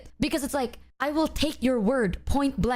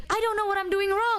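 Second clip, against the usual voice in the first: anxious, confused; 10 semitones higher than usual. Real, not acted. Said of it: "because now I am scared".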